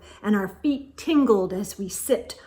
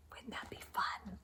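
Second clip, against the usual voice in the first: whisper; 2 semitones lower, 22 dB quieter than usual. Real, not acted. Parent whispering an aside to the listening child.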